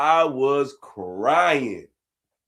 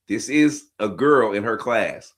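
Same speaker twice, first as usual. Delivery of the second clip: usual speaking voice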